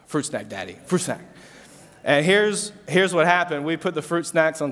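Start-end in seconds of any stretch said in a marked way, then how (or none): 0.0-1.2 put-on soft, lower little-kid voice for Gus; lightly voiced toddler begging. A dad imitating his toddler son Gus asking for fruit snacks, soft and small. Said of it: "this is how he says it".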